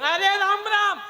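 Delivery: high, loud protest; exasperated insistence. Acted. Brother answers disbelieving Kauravas, exasperated insistence. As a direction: cry it out as the only answer. Exasperated repeated reply in quarrel.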